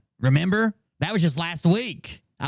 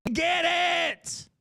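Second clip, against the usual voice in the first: high slow exasperation; 12 semitones higher, 3 dB quieter, much slower than usual. Real, not acted.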